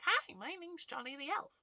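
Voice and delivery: high bright cheery elf greeting; squeaky upbeat elf, to children. Shown as a demonstration. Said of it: "talking and interacting as an elf".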